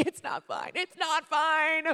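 imitated sobbing wail, squealing up high; crack, then wail it slowly, hammed-up; tearful breakdown. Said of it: "Someone gives you a hug".

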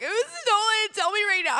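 very high, loud mock wail; hammed-up tearful rage, squeal it out; angry crying. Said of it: "you start crying".

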